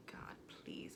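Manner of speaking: breathless whisper begging God; whispered plea. Retold or imitated. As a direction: whisper it like a secret prayer. Whispered prayer on her knees.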